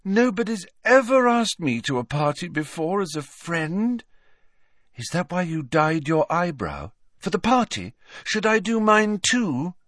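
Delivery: put-on high-pitched girl's voice, thrilled; delighted, artless Luna. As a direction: bubble over with innocent questions, pitched well up.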